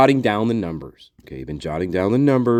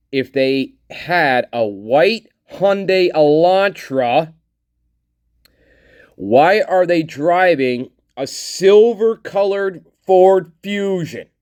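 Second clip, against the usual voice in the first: incredulous; 6 semitones higher, slower than usual. Real, not acted. Incredulous at the wrong test car.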